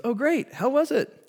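high, bright mock wife voice; wife from the shower. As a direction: lightly play the wife calling back. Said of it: "And she goes".

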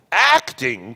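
loud, outraged cry; screamed. A narrator's reading. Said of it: "screamed the tragedian".

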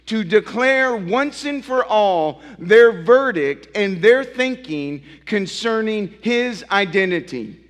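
rising pulpit fervour; full-force preaching. Acted. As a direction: preach it out to the congregation.

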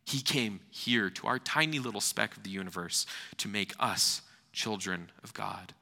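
ordinary talk, nothing marked

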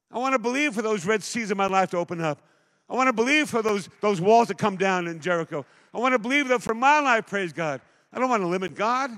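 loud, high-pitched full-force preaching; fervent preaching. Acted. Pastor urging his church to believe, full-force preaching. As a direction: pour it out with fire to the congregation. Opening fervent sermon appeal.

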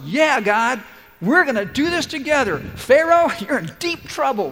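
high, loud, with a laugh, all fired up; hyped shout with a laugh; eager pumped-up Moses. Pretend. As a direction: cheer it like you can't wait for the fight. Moses imagined eager and bold.

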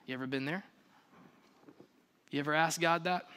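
ordinary delivery

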